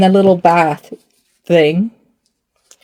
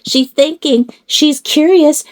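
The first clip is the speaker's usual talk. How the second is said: cooing; 6 semitones higher than usual. Pretend. Cooing about doll.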